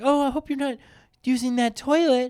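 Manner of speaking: very high falsetto woman's voice; mock female impression. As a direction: squeak it out as a caricatured woman. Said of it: "that's my impression of all women".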